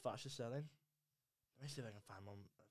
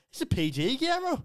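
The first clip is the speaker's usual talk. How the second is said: loud plea leaping very high; hammed-up squeal of objection; exasperated mock protest; 12 semitones higher, 9 dB louder than usual. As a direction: call out the director in high-pitched disbelief. Retold protest to the film's director.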